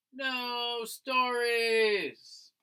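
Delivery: a long hopeless groan of a line; drawn-out despair. A narrator's reading. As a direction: moan it out in frustration. Despair, no stories found.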